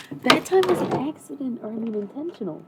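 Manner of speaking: high, loud, flustered; flustered surprise. Real, not acted. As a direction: blurt it out, still rattled. Startled by incense.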